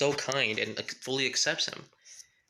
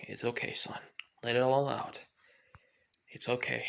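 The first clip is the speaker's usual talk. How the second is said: soothing comfort; 2 semitones lower, 3 dB quieter than usual. Acted. Father comforting crying Todoroki.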